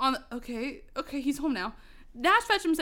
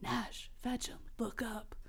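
soft unvoiced whisper; distant voice; 14 dB quieter than usual. The voice calls Nash, a distant whisper. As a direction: whisper it from far away. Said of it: "But the voice said".

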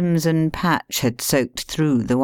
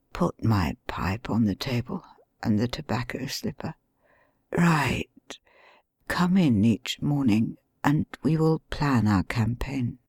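hushed bedridden plotting; weak injured man; 2 semitones lower, 5 dB quieter than usual. Acted. Injured Holmes, faint voice.

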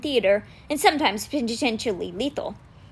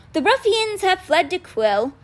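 slow, grand, higher stage voice; theatrical old teacher; 6 semitones higher, slower than usual. A narrator's reading. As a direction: declaim it like a pompous poet. Appleblossom's theatrical voice.

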